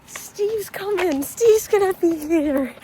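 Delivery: giddy sing-song announcement; excited. Real, not acted. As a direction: savour the news, stretching each word up high. Excited news that Steve is coming.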